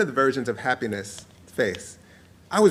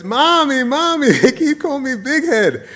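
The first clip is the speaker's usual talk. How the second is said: very high, hammed-up kid wail; mock-crying child voice, breaking into laughter; inconsolable child; 11 semitones higher, 4 dB louder than usual. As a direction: wail it like a bawling little boy. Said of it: "this time inconsolable".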